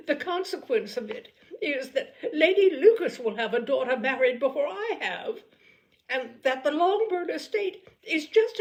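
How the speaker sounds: high, loud, peevish complaining matron; a nervous mother airing her grievances, pitched high; fretful mother. Acted. Mrs Bennet's complaining speech.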